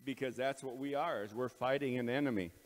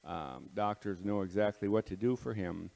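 prayer; 4 semitones lower than usual. Real, not acted. Closing prayer before devotion.

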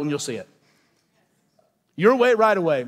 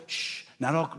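hushed mock warning; 2 semitones lower, 7 dB quieter, slower than usual. Culture silencing Christians.

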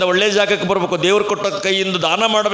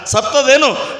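sharp question; 6 semitones higher, 4 dB louder, slower than usual. Acted. King demands if it died.

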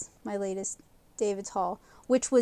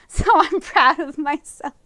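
excited; 5 semitones higher, 6 dB louder than usual. Real, not acted. Proud of finished painting.

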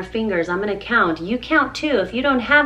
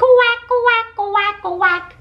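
high, louder duck quack; mama duck quack; 10 semitones higher, 4 dB louder, much slower than usual. Acted. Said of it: "Mother duck said".

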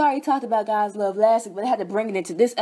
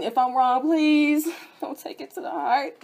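normal talking voice

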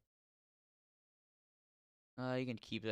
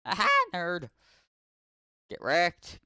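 loud, high triumphant jeer; mock the defeated foe; loud victorious taunting; gloating taunt; 7 semitones higher, 10 dB louder, slower than usual. Real, not acted.

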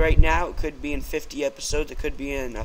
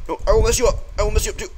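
pitched-up yelled threat; high, loud threat; 7 semitones higher, 4 dB louder, much faster than usual. Real, not acted. Gamer fighting a skeleton, high yelled threat. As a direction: shout it at the skeleton in a panic.

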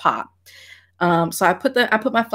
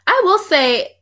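usual speaking voice

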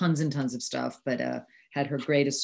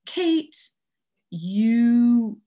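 professor's voice; 8 semitones higher, 6 dB louder than usual. Retold or imitated. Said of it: "one of my professors said to me".

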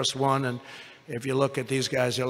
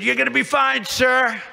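mock captain reassurance; 8 semitones higher, 4 dB louder than usual. Captain voiced reassuring, high.

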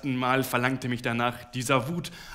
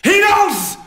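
much higher, much louder bellow; roaring shout; 14 dB louder than usual. A narrator's reading. Husband bellowing at his wife to get out, roaring shout. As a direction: bellow the single word. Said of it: "brüllte ich noch lauter".

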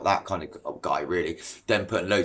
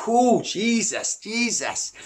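startled; 10 semitones higher, much slower than usual. Real, not acted. Startled by something.

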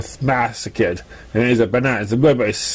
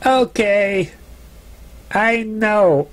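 lightly voiced Raindrops, exasperated; 8 semitones higher, much slower than usual. Raindrops entering kitchen.

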